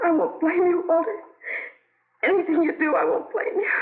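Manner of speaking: pleading through tears with her husband; crying. Acted. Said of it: "Nothing to cry about".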